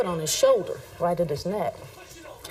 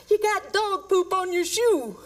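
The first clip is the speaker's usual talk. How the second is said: much higher, slow, cracking up; laughing through the quote; 14 semitones higher, slower than usual. Retold or imitated. Officer retelling the suspect's punchline, laughing, higher and slow. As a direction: barely get the words out for laughing. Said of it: "And he said".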